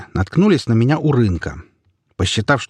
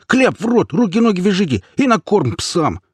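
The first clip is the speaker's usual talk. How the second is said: high, frantic squealed orders; flaring up; 11 semitones higher than usual. A narrator's reading. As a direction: shriek the orders in outrage. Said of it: "взвился хомяк".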